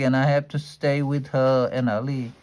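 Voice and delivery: plain reading voice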